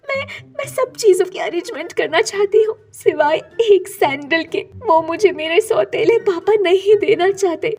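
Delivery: acted crying, longing for papa; tearful pleading. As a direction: plead to an absent father, acting tearful. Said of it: "तस्वीर से रोते हुए बात कर रही".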